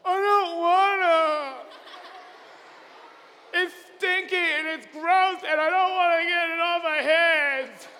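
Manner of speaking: put-on a bratty kid refusing a chore; whiny kid. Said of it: "And you're like".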